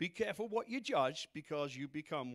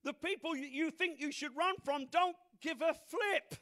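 high, raised preaching voice; impassioned preaching; 9 semitones higher, 5 dB louder than usual. Real, not acted.

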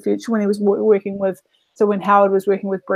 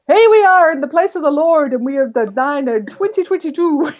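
higher, put-on Norwegian-Southern accent; 5 semitones higher, 3 dB louder than usual. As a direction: mimic a man who sounds part Norwegian, part Southern. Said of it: "kind of sounds Norwegian Southern".

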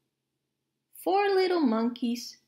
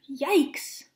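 startled gasp. Acted. Startled at the crash in story.

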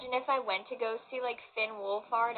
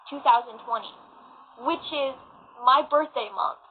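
ordinary talk

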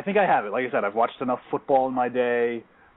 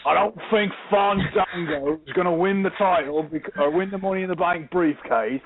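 hammed-up British accent, higher pitched; mock British accent; 5 semitones higher than usual. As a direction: put on an exaggerated British voice. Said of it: "I don't mean to offend them by doing this".

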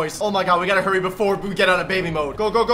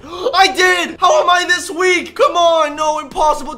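real alarmed yell, higher and louder; shocked shout; 6 semitones higher, 5 dB louder than usual. Real, not acted. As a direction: cry out in disbelief at the damage. Shocked at taking damage.

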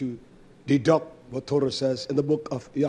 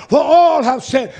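slow loud repeat; 9 semitones higher, 12 dB louder than usual. Real, not acted.

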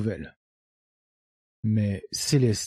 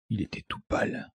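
ordinary delivery

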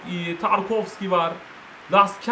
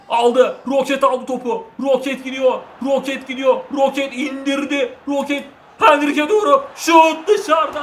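excited play-by-play; 5 semitones higher, 4 dB louder than usual. Real, not acted. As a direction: build the call, then shout the miss. Attack builds, shot misses.

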